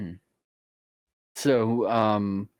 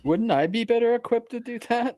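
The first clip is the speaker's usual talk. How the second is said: high character voice; 13 semitones higher than usual. Pretend. Seagull player asking in character.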